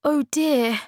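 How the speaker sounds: high, flustered little cry; blushing child caught out; embarrassed Moomin Troll. Acted.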